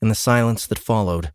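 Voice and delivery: ordinary delivery, nothing marked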